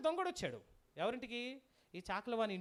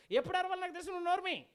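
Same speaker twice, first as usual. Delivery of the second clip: high, raised acted snapping back; mock-angry comeback, pitched up; irritated retort; 6 semitones higher, 4 dB louder, faster than usual. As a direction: tell them off forcefully as the fed-up dog. Dog's retort replayed with more force.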